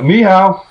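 "ni hao" said high and bright as a Mandarin greeting. Shown as a demonstration. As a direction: say "ni hao" like a Chinese hello. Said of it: "It's hello in Chinese".

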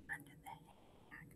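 barely audible whisper; whispered echo. Shown as a demonstration. Teacher leaves room for class to repeat, whispering faintly.

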